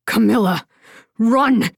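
urgent call, pitch lifting on the last word; understated shout of warning. A narrator's reading. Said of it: "the man shouted".

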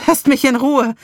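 high, loud desperate cry; pained gasp. A narrator's reading. Narrator fending off the voices, pained outcry. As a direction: gasp it out in violent pain. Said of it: "Ich keuchte angesichts des heftigen Schmerzes".

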